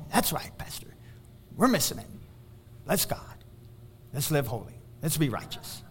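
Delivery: religious congregation. Retold or imitated. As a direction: imitate a congregation piously agreeing, voice dropping low. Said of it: "it sounds so good and so religious".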